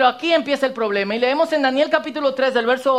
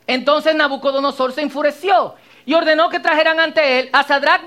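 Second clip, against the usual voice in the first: ordinary talk, nothing marked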